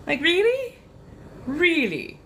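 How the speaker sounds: high, disbelieving question; incredulous. Real, not acted. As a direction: ask it in disbelief. Incredulous reaction.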